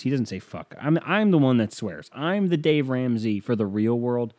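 normal talking voice

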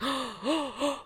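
hammed-up gasps, high and soft; mock shocked gasps. Friends hear the secret, mock shocked gasps. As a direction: gasp in pretend shock.

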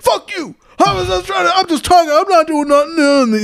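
high-pitched indignant shouting act; mock angry protest. Man answers the yelling manager, mock angry protest. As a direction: imitate a man protesting being thrown out. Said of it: "He's like".

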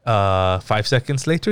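ordinary talk, nothing marked

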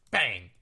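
sound effect. Shown as a demonstration. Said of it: "Don't I make good sound effects?".

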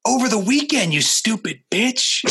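hammed-up sketch outburst; Chris Farley snap. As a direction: sketch character losing patience with a nagging host. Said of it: "that SNL thing".

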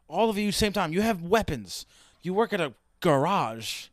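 ordinary talk

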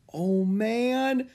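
high amazed gasp of words; awed. Acted. Jack seeing the penguin, high amazed. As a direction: struck by the sight.

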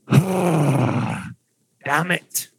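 put-on gruff Dwarvish-accented curse; botched elf line in a dwarf accent; wrong-voice dwarvish. GM voicing the elf in a dwarf accent by mistake. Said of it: "I did the wrong voice".

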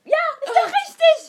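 high, very loud outburst; loud, high-pitched exclamation; exasperated confirmation. Real, not acted. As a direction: shout that they finally have it. Exasperated confirmation after attempts.

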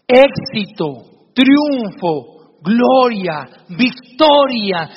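father teaching his son the good words; slow, uplifting words. Acted. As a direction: proclaim each bright word with pride. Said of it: "le dice el papá".

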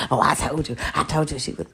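mock gloating. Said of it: "they gonna see".

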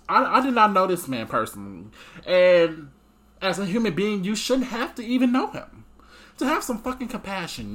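upset. Real, not acted. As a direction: voice rising in anguish. Tearful outburst about compassion.

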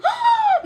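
sharp high gasp of outrage; indignant gasp. Retold or imitated. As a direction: gasp like an offended child. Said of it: "muito indignada".

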